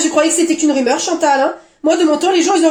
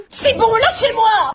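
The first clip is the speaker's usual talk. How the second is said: high, drawn-out embarrassed shout; flustered cry to be left alone; exasperated outburst; 5 semitones higher, slower than usual. Acted. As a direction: fend off the teasing, slow and high. Embarrassed girl snaps at teasing.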